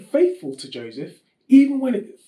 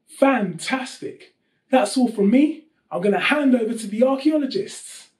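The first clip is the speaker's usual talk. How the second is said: normal talking voice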